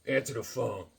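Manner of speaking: low, soft lazy dad mutter; a touch of a low lazy dad. Retold or imitated. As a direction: grumble it from the couch. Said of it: "He'd be on the couch".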